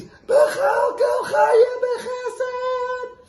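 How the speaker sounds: very high, loud and drawn out, a wild imitation cry; mimicked scream. Jester mimicking the Rebbe at a wedding, screaming. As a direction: belt it out mocking the Rebbe's prayer. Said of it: "he jumps up and he screams".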